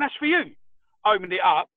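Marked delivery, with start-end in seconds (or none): 0.0-0.5 higher, pointed repeat of That's for you; a light imitation of the wife insisting. Wife repeats to husband the parcel is his, pointed and higher.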